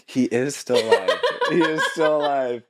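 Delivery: real laughter through the words; amused, laughing insistence; laughing reassurance. Real, not acted. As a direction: answer the joke while laughing. Laughing answer to joking question.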